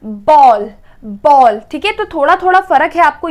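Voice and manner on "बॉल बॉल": बॉल with a lengthened aw, pitched lower and slow; teacher showing the longer vowel. Shown as a demonstration. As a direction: stretch the aw in बॉल. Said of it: "थोड़ा सा और long".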